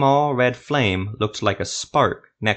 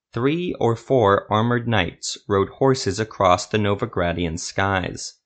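usual speaking voice

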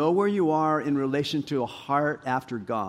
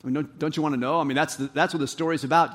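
ordinary talk

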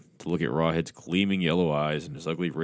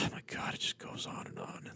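whispered exasperated aside; weary whisper; 15 dB quieter than usual. Real, not acted. Reader groaning at the long text, whispered. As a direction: sigh it under your breath, fed up.

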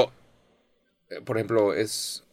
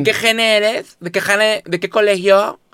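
higher, louder mock fresa girl; fresa girl mockery; 9 semitones higher, 7 dB louder, slower than usual. Mocking fresa girls who ask what generation and school, hammed-up high voice. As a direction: ham up the posh-girl question, "gene" and "colegio" sung up. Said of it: "las mismas morras que dicen".

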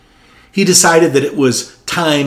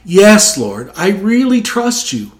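higher, louder, straining; strained reply; 8 semitones higher, 4 dB louder than usual. Retold or imitated. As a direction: answer while hanging on. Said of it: "The man, strained to hold on".

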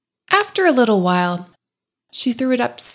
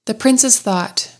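plain reading voice

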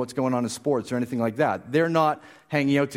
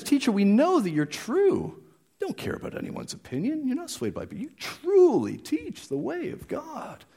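mocking flattery; 12 semitones higher than usual. Preacher mocking the Pharisees' empty praise. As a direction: sing it up high, sweet and fake. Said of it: "a bit of mockery kind of in their voice".

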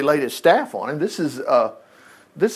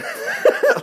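breathy high laugh. Real, not acted. Excited laugh at miracle.

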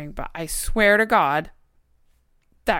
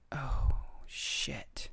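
whispered dread; whispering; 6 semitones lower, 9 dB quieter than usual. Retold or imitated. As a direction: breathe it out in alarm. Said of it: "I was like".

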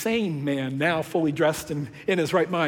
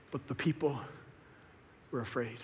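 much softer and lower; quiet grave reveal; hushed, somber; 6 semitones lower, 14 dB quieter than usual. Real, not acted. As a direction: let it fall away quietly. Somber turn in story.

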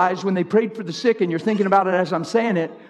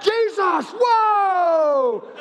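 mock showy prayer; 10 semitones higher, much slower than usual. Mocks showy prayer style.

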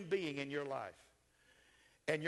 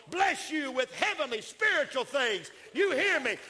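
loud, high, shouted preacher's proclamation; full-force preaching; 10 semitones higher, 7 dB louder than usual. Acted. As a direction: shout the promise over the crowd.

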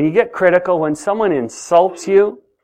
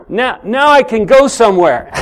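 laughing with delight; a bright laugh through the words; 7 semitones higher, 3 dB louder than usual. Real, not acted. Excited demo with inflated tire.

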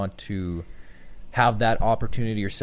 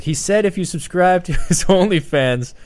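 loud, high announcer relaying a joke to the crowd; announcer on the mic; 8 semitones higher, 8 dB louder than usual. Retold or imitated. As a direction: boom it over the arena PA. Guy relays quote over mic.

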